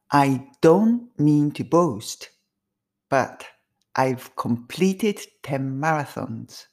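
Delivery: very slow, each word drawn out; slow first take. Shown as a demonstration. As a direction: say it slowly the first time for repeating. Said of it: "一回目はゆっくり".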